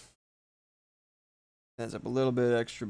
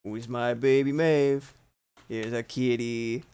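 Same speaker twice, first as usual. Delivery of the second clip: talking to a cat; 8 dB louder, slower than usual. Real, not acted. Cooing to her cat.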